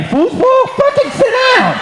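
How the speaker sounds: mock outrage at the foosball plan; incredulous high yell. Real, not acted. Comic to the man heading off to play foosball, high incredulous yell. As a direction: pitch way up in disbelief. Yelling at a crowd member.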